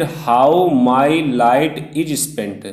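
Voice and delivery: "how my light is spent" slowly, one beat per word; syllable by syllable. Shown as a demonstration. Teacher counting syllables in the poem line, word by word. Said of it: "इसमें कितने?".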